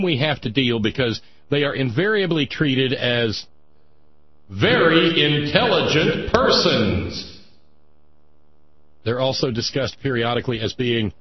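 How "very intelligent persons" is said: mock praise, drawn out and loud; sarcastic. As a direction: lay the sarcasm on thick. Mocking the pundit's praise.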